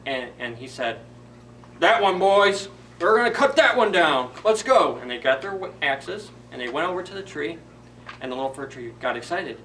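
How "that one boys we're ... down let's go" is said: loud, high boss yelling to his crew; shouted order. Acted. Lumberjack boss shouting to his men, loud. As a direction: bellow it across the forest.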